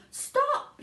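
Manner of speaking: piercing high shout. Acted. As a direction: bellow it in alarm. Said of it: "shouted Grandpa Joe".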